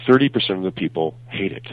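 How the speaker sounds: ordinary talk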